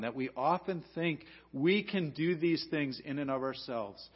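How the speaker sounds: ordinary talk, nothing marked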